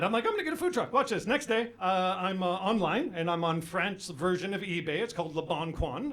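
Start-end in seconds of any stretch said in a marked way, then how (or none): 0.3-0.9 high-pitched indignant retort; mock mad. Husband answers wife's patronizing remark, mock mad. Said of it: "That made me mad".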